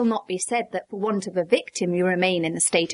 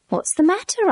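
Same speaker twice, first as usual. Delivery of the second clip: surprised question; 11 semitones higher than usual. A narrator's reading. Narrator asks startled friend.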